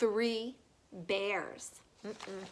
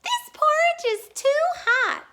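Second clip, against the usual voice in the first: much higher, louder squeaky cub voice; Baby Bear complaining; 5 dB louder than usual. A narrator's reading. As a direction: squeak it like a scalded tiny cub. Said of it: "cried Baby Bear".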